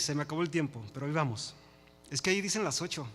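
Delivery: normal talking voice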